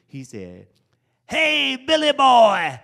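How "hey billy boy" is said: put-on high, loud greeting as the old mentor; playful old man's loud hello; raspy old man. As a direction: mimic the old teacher hailing him. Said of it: "in his raspy voice I remember".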